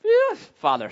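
very high mock-relieved child; hammed-up grateful son. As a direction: play a sweet boy sighing with relief. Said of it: "I'm not expecting him to be like".

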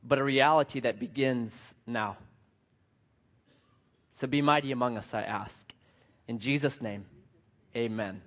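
hushed prayer voice. Acted. Closing prayer.

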